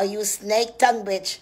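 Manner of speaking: usual speaking voice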